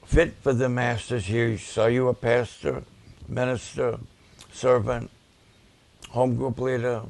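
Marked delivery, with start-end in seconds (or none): none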